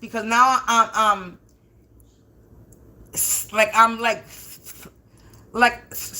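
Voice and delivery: halting, stuttering speech. Real, not acted. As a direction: trip over the words. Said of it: "slurring on my words or something".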